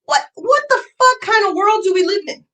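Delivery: crying outrage. Real, not acted. Outburst near tears.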